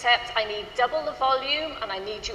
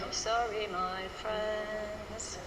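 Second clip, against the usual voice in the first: softer, listless delivery; limp, low energy; 8 dB quieter, much slower than usual. Shown as a demonstration. Choir leader mocking a flat, half-hearted reading. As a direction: say it like you don't care. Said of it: "doesn't really cut it".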